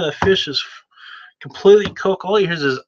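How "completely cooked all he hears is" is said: plain reading voice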